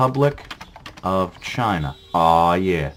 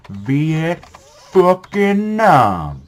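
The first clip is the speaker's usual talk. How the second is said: very drawn-out, raised, loud proclamation; hammed-up announcement; 7 semitones higher, 5 dB louder, much slower than usual. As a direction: stretch every syllable out theatrically. Hammed-up announcing of country name.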